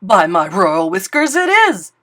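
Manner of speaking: loud delighted royal exclamation; king crying out at the oobleck; excited cry. Acted. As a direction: burst out in royal glee. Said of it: "he cried".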